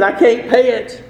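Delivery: loud, high mock plea; pleading debtor. Debtor to the man demanding payment, acted plea. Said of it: "And that man said".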